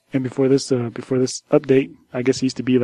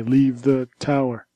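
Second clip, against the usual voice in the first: slow, drawn-out protest slogan; measured mock-protest chant; slower than usual. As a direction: stretch out each word like a picket chant. Acting out his protest sign.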